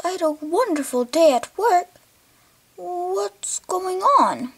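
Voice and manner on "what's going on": slow whispered question. Acted. Dad asks, repeats it.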